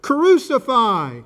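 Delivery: high, loud, stretched-out cry; crowd shout. A narrator's reading. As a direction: shout each word long, as a mob would. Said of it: "they shouted".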